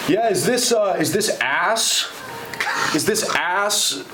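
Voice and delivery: put-on old caller. Retelling the elderly caller asking for Ace, slow old man voice. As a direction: drag the words like a confused old man on the phone. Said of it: "And then the guy goes".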